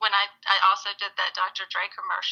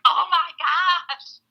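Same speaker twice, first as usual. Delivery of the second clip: slow, much higher and louder, imitating the awed girls; starstruck gasp; 10 semitones higher, 5 dB louder, much slower than usual. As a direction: drag out the amazement. Starstruck daughters voiced in story.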